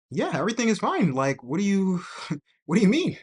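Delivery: imitating an irritated wife, high and loud; defensive high-pitched shout, acted out; mock-indignant wife insisting nothing is wrong; loud, aggressive. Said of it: "it's just loud and aggressive".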